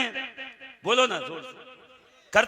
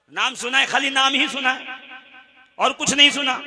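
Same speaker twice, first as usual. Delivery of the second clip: usual speaking voice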